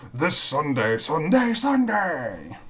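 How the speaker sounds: monster truck ad. Pretend. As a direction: hype it like a Sunday Sunday Sunday ad. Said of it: "that guy that used to do the, uh, Monster Truck commercials".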